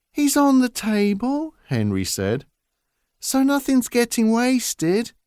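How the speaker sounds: a big lad owning up meekly; sheepish giant son. Acted. Henry's voice again.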